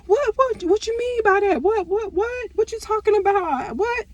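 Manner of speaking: high, stammering fake confusion; flustered cousin. Cousin caught out, high stammering playing dumb. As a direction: play dumb, stumbling over what. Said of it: "she was like".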